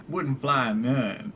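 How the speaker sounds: slow drawled wouldn't fly none; mock southern drawl. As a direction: stretch it out in a lazy Texan drawl. Folksy southern variant.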